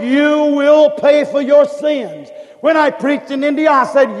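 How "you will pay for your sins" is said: slow, loud pulpit shout; thundering sermon warning; preaching warning. Retold or imitated. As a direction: hammer the warning at the crowd.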